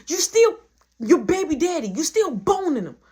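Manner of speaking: high, loud retold accusation; heated accusation. Retold heated quarrel, accusing her.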